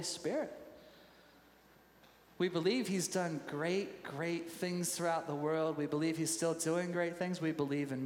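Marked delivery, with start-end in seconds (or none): none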